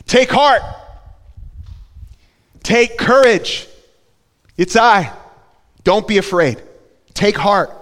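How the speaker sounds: loud, high call of take heart over the wind; Jesus calling out to the disciples over the storm, loud; shouted over storm. Shown as a demonstration. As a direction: shout it across the storm winds. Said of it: "It was storming out".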